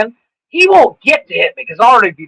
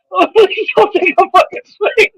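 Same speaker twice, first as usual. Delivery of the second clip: higher, hammed-up scared plea; mock pleading; 11 semitones higher than usual. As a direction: beg like a terrified pad holder. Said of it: "and he's like".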